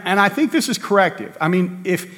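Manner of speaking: normal talking voice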